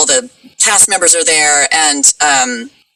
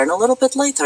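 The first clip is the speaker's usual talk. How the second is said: higher-pitched, lightened Russian accent demo; lighter Russian accent; 8 semitones higher, 3 dB quieter, faster than usual. Shown as a demonstration. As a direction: ease the Russian accent another notch. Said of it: "get a little bit lighter".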